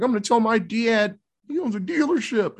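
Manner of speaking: high, whiny tattletale voice; put-on brat, pitched well up; bratty kid. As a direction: play a spoiled son threatening to tell dad. Voicing the slapped Russian brat.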